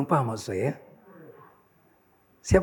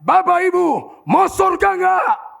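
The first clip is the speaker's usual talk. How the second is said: high, drawn-out street-caller shout; 14 semitones higher, 7 dB louder, slower than usual. Retold or imitated. Preacher re-enacts calling to sleeping train passengers, shouting. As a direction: holler it slowly to a whole carriage. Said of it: "saya teriak".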